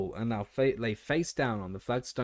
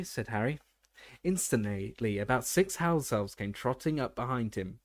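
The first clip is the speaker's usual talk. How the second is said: usual speaking voice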